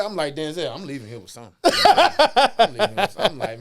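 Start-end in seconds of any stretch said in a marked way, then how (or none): 1.6-3.3 loud and high, yelled out; shouting. Retold or imitated. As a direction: belt it like a vow. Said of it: "in my head".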